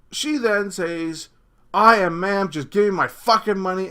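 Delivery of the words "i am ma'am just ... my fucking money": imitating an angry, raised-voice demand; higher, louder mock outrage; going apeshit. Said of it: "she's going apeshit".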